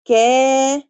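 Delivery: كا with the a held long; stretched long a. Shown as a demonstration. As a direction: draw out كا. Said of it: "أقول كا".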